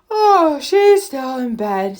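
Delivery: sleepy, slow words stretched by a yawn; drowsy mock yawn. A narrator's reading. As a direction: yawn your way through it, just out of bed. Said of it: "said Brother with a yawn".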